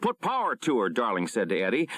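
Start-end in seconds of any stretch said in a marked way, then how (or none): 0.0-0.9 much higher, urgent command; captain ordering power to the sub. A narrator's reading. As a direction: snap out the order.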